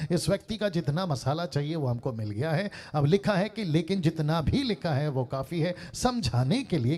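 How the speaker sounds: loud, high preaching voice. Acted. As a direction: preach it out with full force. Pastor preaching to congregation.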